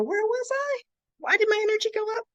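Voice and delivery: squeaky puzzled self-question, rushed; high confused voice. Retold or imitated. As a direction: ask it high and baffled, fast.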